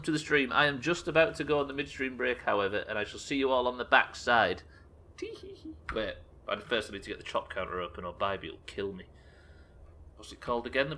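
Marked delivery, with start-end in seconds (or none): 5.2-5.7 high whispered mock tee hee; a cheeky little whispered titter; whispered giggle. Teasing chat before the break, whispered high giggle.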